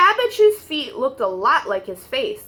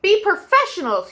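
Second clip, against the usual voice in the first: higher, pleading shout; a strained cry for order from the conductor; raised, half-desperate plea; exasperated shout; 6 semitones higher than usual. Acted. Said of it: "he shouted".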